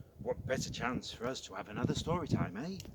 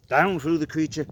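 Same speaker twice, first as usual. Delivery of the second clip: much louder, bold narration; dramatic announcement; 6 semitones higher, 13 dB louder than usual. A narrator's reading. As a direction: make the entrance big.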